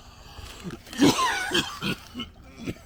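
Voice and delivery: hacking, drawn-out coughing fit. Real, not acted. Vlogger caught by bug repellent, coughing hard. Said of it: "coughing like this".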